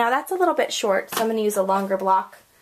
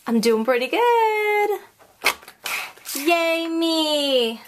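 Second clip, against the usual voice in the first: self-cheering; 6 semitones higher, much slower than usual. Real, not acted. Self-praise in high voice.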